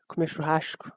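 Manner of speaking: Comer churrasco, quietly, with a hushing "sh" before the c; strong Rio S. Shown as a demonstration. Showing the Rio way to say Comer churrasco, strong hushed S. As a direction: lean on the "sh"-like S in churrasco. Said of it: "with a very strong ass".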